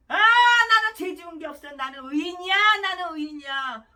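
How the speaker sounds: loud smug self-righteous sinner; mocking quote. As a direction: sneer out the boast, loud start. Preacher mocks sinner's boast.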